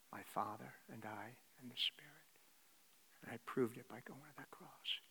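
hushed unvoiced whisper. Preacher whispering Jesus' promise to each listener. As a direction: drop to a secret whisper. Intimate confession of Jesus' love.

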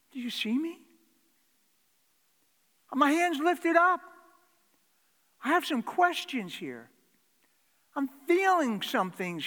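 loud, high, pleading prayer; impassioned prayer. Acted. Preacher voicing David, loud pleading prayer. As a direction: cry out to God at full force.

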